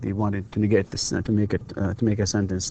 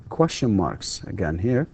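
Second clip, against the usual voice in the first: ordinary delivery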